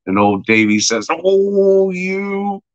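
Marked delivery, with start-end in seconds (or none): 1.1-2.6 high, drawn-out mock cooing; high exclamation. Old Davey answers the baggage handler, high drawn-out exclamation. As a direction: stretch it out way up high.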